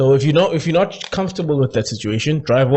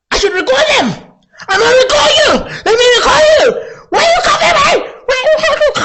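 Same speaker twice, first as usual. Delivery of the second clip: very high, loud, crying shriek; hammed-up high shrieking girl voice; mock sister shrieking; 8 dB louder, faster than usual. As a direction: scream it at the cops like the tipsy sister. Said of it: "Not my sister".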